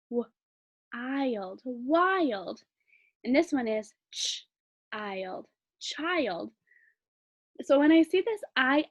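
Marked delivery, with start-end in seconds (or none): 0.1-2.6 WILD split as "w-", "eye-ld", then blended; sounding out. Shown as a demonstration. Teacher building a new word, sounding out. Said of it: "This would make this word". 4.1-6.5 put-on CHILD split as "ch-", "eye-ld", then blended; sounding out. Shown as a demonstration. Teacher changing the first sound, sounding out. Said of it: "change the first sound".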